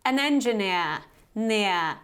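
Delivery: engineer in a British accent, final R silent; British "-neer" ending with no R; British variety. Shown as a demonstration. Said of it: "in the British variety".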